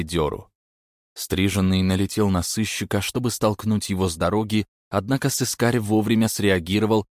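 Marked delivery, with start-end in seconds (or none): none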